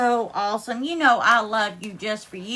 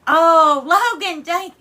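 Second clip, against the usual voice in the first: a loud, higher burst of gratitude; moved, exclaiming; 4 semitones higher, 8 dB louder, slower than usual. Real, not acted. Streamer overwhelmed by Logan's gifts, loud and moved. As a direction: cry out thanks, touched. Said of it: "Y'all gonna make her cry".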